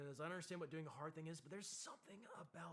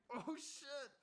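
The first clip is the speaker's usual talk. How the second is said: startled; 13 semitones higher, 5 dB louder than usual. Retold or imitated. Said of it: "I'm like".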